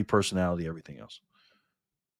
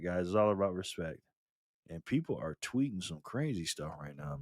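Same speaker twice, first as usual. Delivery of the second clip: ordinary talk, nothing marked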